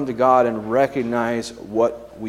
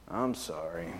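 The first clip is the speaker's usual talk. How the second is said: low, soft, insincere sorry; forced apology, dropped low and quiet; grudging child's apology; 9 semitones lower, 14 dB quieter than usual. Acted. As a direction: mumble an apology you don't mean. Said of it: "what does the child say?".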